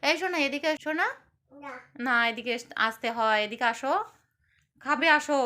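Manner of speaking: loud call to come eat; yelling across the room. Real, not acted. Mother calling child to eat.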